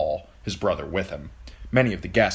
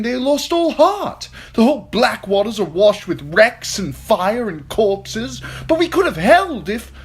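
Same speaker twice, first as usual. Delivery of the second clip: high, loud, frantic knight bursting in with bad news; breathless soldier shouting the battle is lost; panicked wounded knight; 14 semitones higher, 6 dB louder than usual. A narrator's reading. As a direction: blurt it out in a high panic, louder and louder. Lancel reports the lost battle.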